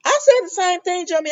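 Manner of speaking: shout-along with the character; excited. Real, not acted. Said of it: "talking back at the TV".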